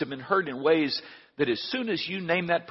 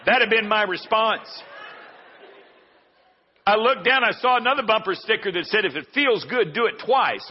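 louder, higher, faster; heated; 5 semitones higher, 3 dB louder than usual. Retold or imitated. Preacher getting worked up telling the story, louder. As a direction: build it up hot.

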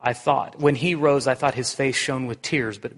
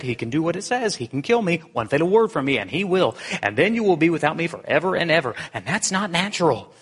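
higher, wheedling creature voice climbing to a loud cry; a lightly voiced lizard pleading urgently; chattering lizard; 6 semitones higher, 3 dB louder than usual. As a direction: lizard pleading with the ghost to spare it. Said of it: "chattering to the ghost so loud".

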